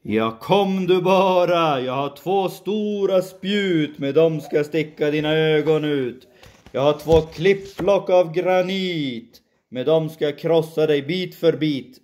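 big goat threat. Acted.

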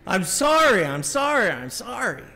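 imitated emphatic, exasperated sorry; exaggerated meaning it. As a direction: overdo the apology like a pressured kid. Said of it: "speak it like you mean it".

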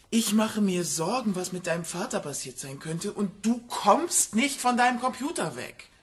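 worried parent losing patience, voice pushed up; exasperated scolding. Acted. Mother scolding her child at the computer, exasperated.

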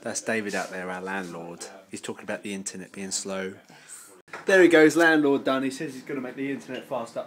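ordinary talk

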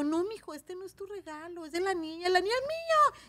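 put-on high kids' host voice; high coaxing. Hablándole a un niño. (voz aguda y dulce hablándole al niño)

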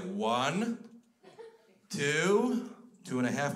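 put-on godfather counting at a misbehaving kid; stern adult countdown with long gaps; warning countdown. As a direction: count slowly as a warning, in your own voice. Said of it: "And I was like".

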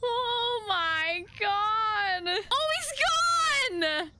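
high, tearful-sounding whine; frightened wail. Real, not acted. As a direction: wail in panicked dismay. Frightened that the rat vanished.

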